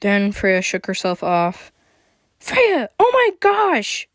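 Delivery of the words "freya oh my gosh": very high, drawn-out cry of alarm; high-pitched shout. A narrator's reading. Motaro running over to hug Freya, high shout. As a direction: cry out high and slow in shock. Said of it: "Motaro shouted".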